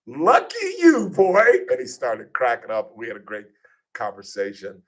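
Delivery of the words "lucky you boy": much higher, slow, drawn-out teasing; mock teasing. Host ribbing the team owner's son, slow high mock voice. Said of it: "He's like".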